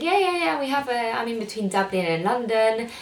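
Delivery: bright quoting of the doctor; doctor quoted. Retold or imitated. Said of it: "And he said".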